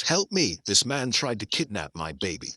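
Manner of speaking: ordinary delivery, nothing marked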